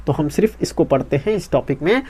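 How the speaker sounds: ordinary delivery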